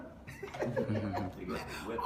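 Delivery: low, quiet chuckle at someone; mocking laugh. Real, not acted. Preacher laughing at the man he scolds, mocking laugh. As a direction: laugh at them, softly and low. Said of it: "I'm laughing at you".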